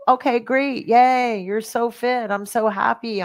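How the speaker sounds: high-pitched token enthusiasm; mock cheering. Tired of seeing great fit older people, mock cheering. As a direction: cheer them on in a put-on, lifted voice.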